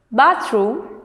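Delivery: "bathroom" with the weight on BATH; stress on first syllable. Shown as a demonstration. Teacher reading words to students, "bathroom" stressed on BATH. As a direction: say "bathroom" as BATH-room, carefully. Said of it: "stress on the first syllable".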